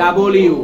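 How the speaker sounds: "ডব্লিউ" as loud da-bol-yoo; correct double-U. Shown as a demonstration. Teacher showing the right W, loud "ডব্লিউ" as da-bol-yoo. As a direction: open out "ডব্লিউ" into double plus U. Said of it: "একসাথে উচ্চারণ হবে".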